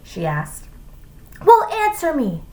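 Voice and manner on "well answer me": high, insistent teacher; demanding. A narrator's reading. Teacher to a silent pupil, demanding. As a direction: demand an answer sharply. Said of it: "she demanded".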